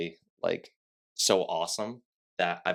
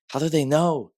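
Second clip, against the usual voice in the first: higher, louder baffled question; mock-baffled quote, pitched up; retold bafflement; 10 semitones higher, 7 dB louder than usual. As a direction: act out puzzled disbelief. Retold bafflement at being recognised.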